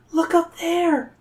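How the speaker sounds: put-on high, drawn-out girlish call without volume; mock yell. A narrator's reading. Zoe points out the pyramid, light high-pitched yell. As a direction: call out high, stretching the words. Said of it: "Zoe yells".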